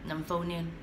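ordinary talk

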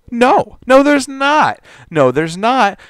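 loud, high-pitched protest; emphatic denial. Real, not acted. Angry rebuttal of quoted tweet.

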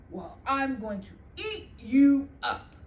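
loud menacing troll. Acted. Troll threatening the little goat, loud and menacing. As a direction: growl it out as a hungry troll.

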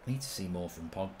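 very quiet, low-pitched aside; low muttered remark. Real, not acted. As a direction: say it low, almost to yourself. Remark before Pogba's goal.

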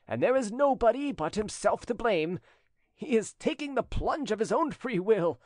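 high-pitched, emphatic scheming; gloating schemer. A narrator's reading. Cousin alone, plotting his rival's ruin, gloating. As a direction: relish the rival's coming downfall.

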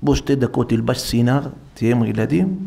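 ordinary talk

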